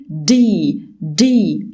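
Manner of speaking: دي said heavy and full, like a thick d. Shown as a demonstration. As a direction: give the D its full weight. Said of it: "اللتر ده مفخم كده".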